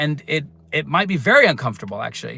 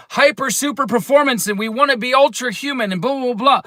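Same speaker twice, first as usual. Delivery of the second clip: mocking; 8 semitones higher than usual. Mocking Western hustle culture.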